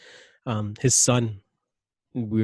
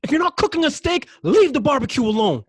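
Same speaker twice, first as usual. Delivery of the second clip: high, loud warning shout; yelling; 10 dB louder than usual. Retold or imitated. Warning the boy off the barbecue, loud high yell. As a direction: bark the order high and loud. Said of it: "so I yelled at him".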